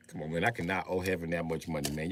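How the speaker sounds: ordinary talk, nothing marked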